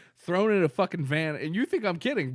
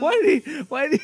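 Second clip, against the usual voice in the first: shouting; 11 semitones higher, 6 dB louder, faster than usual. Real, not acted. Laughing shouted protest.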